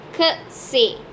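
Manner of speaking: que c'est as two syllables, "kuh seh"; pronunciation demo. Shown as a demonstration. Teacher showing the other part, que c'est as "kuh seh". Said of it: "is pronounced as in".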